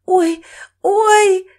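high, loud pained "oy"; drawn-out wail of pain; cry of pain. Acted. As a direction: cry out doubled up in agony. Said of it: "скорчилась от боли".

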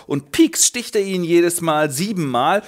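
normal talking voice

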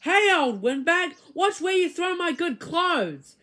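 put-on nasty boy hissing at his teacher; hissed. A narrator's reading. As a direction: hiss it at the teacher. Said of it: "Sammy hissed".

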